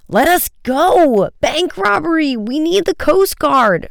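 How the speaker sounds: high, drawn-out frantic cry; slow, loud, high-pitched pleading; gasping plea. A narrator's reading. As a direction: cry out desperately for help.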